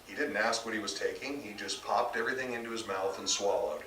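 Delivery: usual speaking voice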